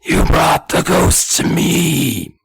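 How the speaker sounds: loud, high, drawn-out straining whisper; harsh stage whisper. Acted. Old man confronting the kids, harsh loud whisper. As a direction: force a slow whisper until the throat hurts. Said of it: "that whisper that destroys my vocal cords".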